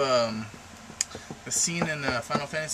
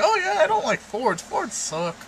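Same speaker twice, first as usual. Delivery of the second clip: high, loud mock put-down; mock dismissive outburst; 6 dB louder than usual. Vlogger acting out a Ford hater's reply, mock dismissive. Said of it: "and then be like".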